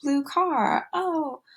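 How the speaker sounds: put-on high, sing-song motherese commenting on the child's play; high-pitched narrating of a toddler's play; parallel talk to a toddler. Shown as a demonstration. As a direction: coo over the child's toy car in a high voice.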